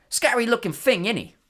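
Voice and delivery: high, loud Cockney lad's voice; chirpy young conductor showing off; cheery bus conductor. Acted. Stan the conductor chats to Harry.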